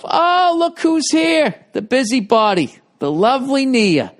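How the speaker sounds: high, loud sing-song greeting; mock grand welcome. Real, not acted. Greeting the arriving co-host Nia with teasing high excitement.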